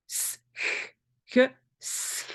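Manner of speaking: "S, ch" as isolated hisses, s then throat ch; separate-sound demo. Shown as a demonstration. Teacher demonstrates the Dutch sch for learners, isolated consonants. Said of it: "C'est un S et un ch".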